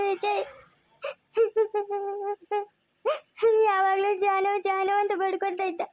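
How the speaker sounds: weeping plea, pitch lifted; grieving mother, breaking into sobs; crying. Acted. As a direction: sob out each phrase, begging for your son. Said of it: "ಅಳ್ಬೇಡ ಸುಮ್ನಿರು".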